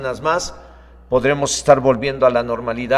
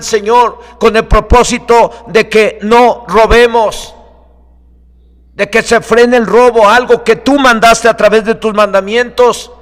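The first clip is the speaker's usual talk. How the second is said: loud, raised preacher's prayer; fervent prayer; 6 semitones higher, 8 dB louder than usual. Real, not acted. Preacher asking God to back his message, fervent prayer. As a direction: plead with God at full force. Prays aloud at preaching force.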